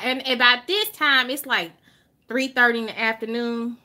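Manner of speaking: ordinary delivery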